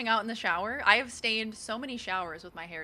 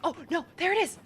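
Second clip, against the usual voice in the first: excited discovery; 8 semitones higher, 6 dB louder than usual. Real, not acted. Player suddenly spots the quest item.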